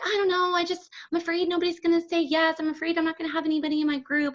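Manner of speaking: high, anxious quoted coach; a touch of a fretting new coach; worried new coach. Retold or imitated. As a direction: voice her nervous worry. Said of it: "and she's like".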